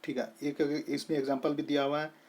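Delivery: usual speaking voice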